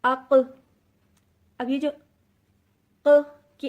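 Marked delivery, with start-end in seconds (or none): 0.0-0.3 اق with a short echoing bounce after the ق; اق ending in a light bouncing ق; qalqala on qaf. Shown as a demonstration. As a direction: release the ق of اق with a tiny rebound. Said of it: "ہم قاف کو ملا کے پڑھیں گے".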